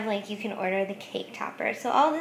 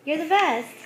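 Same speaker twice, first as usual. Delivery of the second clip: higher and louder, gushing praise; excited husband; 6 semitones higher, 6 dB louder than usual. Retold or imitated. Husband in a cake ad gushing over his wife's cake, mock excited. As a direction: push the second one up and out with glee. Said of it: "the husband's like".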